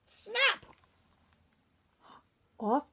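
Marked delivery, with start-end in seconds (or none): none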